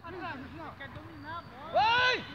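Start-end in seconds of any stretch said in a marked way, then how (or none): 1.7-2.2 high, loud shout. Real, not acted. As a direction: yell it across the field. Calling out during football match.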